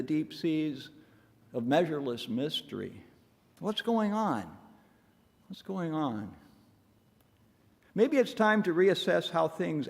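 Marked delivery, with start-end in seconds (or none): 3.6-4.4 higher-pitched puzzled question; wondering question. Acted. Preacher plunged into measureless mystery, wondering aloud in a higher pitch. 5.5-6.3 softer echo of the question; hushed wondering. Acted. Preacher plunged into measureless mystery, musing softly to himself.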